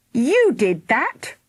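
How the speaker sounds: slow, loud, high snarl; mother snarling an accusation, slow and loud. Acted. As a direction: spit the blame at her daughter. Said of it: "snarled Missus Thunder".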